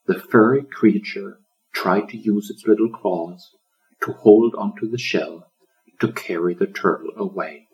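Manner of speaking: ordinary delivery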